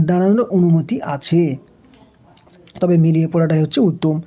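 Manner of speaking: normal talking voice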